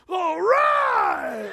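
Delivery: put-on high, loud whoop of a little boy; mock kid cheering at full voice; triumphant kid cheer. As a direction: shout it like a toddler proud of his crash. Son cheers after falling.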